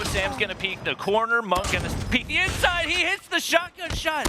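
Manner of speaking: high, hyped shotgun call; excited esports caster. Real, not acted. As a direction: shout the clutch play. Caster calls a shotgun clutch.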